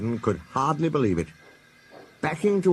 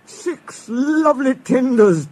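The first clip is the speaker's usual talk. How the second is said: high, louder, slow gloating relish; Henry gloating over the tenders; chortling; 14 semitones higher, 6 dB louder, slower than usual. Acted. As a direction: savour it with glee. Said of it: "chortled Henry".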